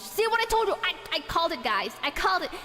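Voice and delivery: loud, high "I called it"; triumphant, told-you-so; crowing at chat about a correct guess; excited gloating. Real, not acted.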